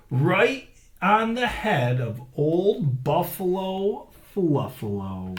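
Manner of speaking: lively read-aloud storytelling; story narration. A narrator's reading. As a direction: read it big for the kids. Reads story to children.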